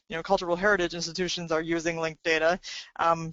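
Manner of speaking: normal talking voice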